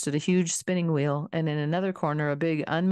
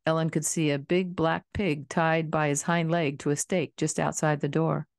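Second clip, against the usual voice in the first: ordinary delivery, nothing marked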